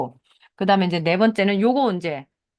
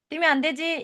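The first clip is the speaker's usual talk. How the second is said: high, sing-song teacherly telling-off; a teacher mildly scolding a running kid; mock teacher scolding; 8 semitones higher than usual. 선생님 꾸중을 흉내. (뛰는 아이를 나무라는 선생님처럼 높게 가볍게)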